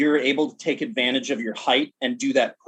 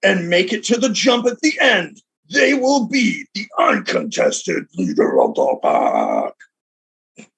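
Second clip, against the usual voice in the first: announcer; 5 semitones higher, 3 dB louder, slower than usual. Retold or imitated. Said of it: "the announcer just says".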